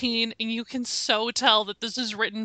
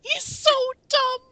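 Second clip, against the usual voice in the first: high exasperated squeal; 11 semitones higher than usual. Real, not acted. Mocking a dumb character.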